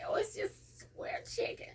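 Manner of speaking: high, slow, breathy fib sold sweetly; sly innocent answer. Retold or imitated. Mother to son, high slow mock-innocent fib. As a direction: play it innocent while fibbing.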